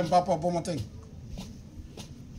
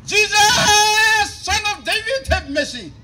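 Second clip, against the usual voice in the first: loud, higher plea of a blind man; crying out for mercy; 10 semitones higher, 8 dB louder, slower than usual. Acted. Blind man calling to passing Jesus, loud cry for mercy. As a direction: call out desperately to Jesus.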